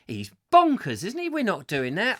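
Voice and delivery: acted disbelief, pitch leaping high; high, protesting mock incredulity; incredulous objection. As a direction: play the bystander who can't believe the order. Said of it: "humanity would go".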